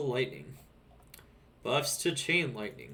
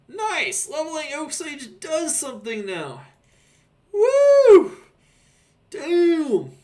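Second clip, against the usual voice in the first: excited; 11 dB louder, slower than usual. Real, not acted. Thrilled by a buff.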